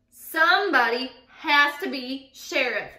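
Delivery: loud, slow wail; wailing mayor. A narrator's reading. Mayor wailing at townspeople who won't volunteer, despairing. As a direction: whine it out in despair. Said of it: "wailed the mayor".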